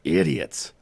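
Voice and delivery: low, contemptuous growl; growled to herself. A narrator's reading. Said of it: "growled to herself".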